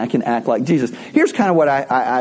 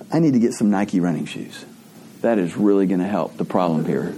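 mock naive self; 7 semitones lower, 3 dB quieter than usual. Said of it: "my automatic response is".

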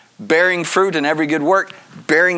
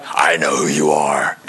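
very low, slow shouted demon snarl; hammed-up demon impression; 12 semitones lower than usual. Shown as a demonstration. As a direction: bellow it like a possessed demon. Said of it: "that's probably my best demon".

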